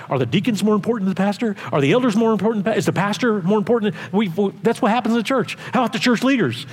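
wyżej, z zapałem kaznodziei; głoś to z ambony, porywająco; kazanie z mocą. Acted. Preaching at full force.

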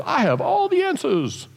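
high-pitched self-important know-it-all; hammed-up all-knowing voice; mock know-it-all. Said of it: "or someone who says".

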